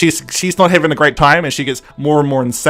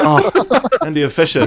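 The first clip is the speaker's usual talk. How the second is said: mock fan swoon with a laugh. Fan hearing a favourite actor start talking, playfully swooning. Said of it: "I swooned as soon as she started talking".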